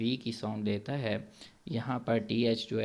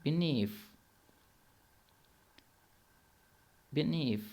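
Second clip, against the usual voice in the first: British بینیف, ending with a clear f, pitched higher; British accent; 5 semitones higher than usual. Shown as a demonstration. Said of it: "یہاں اینڈ پر ایف کی sound آئے گی".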